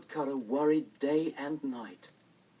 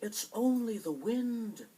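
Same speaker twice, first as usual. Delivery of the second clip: put-on innocent children; 10 semitones higher, slower than usual. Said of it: "The children answered".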